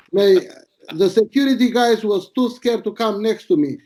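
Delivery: ordinary delivery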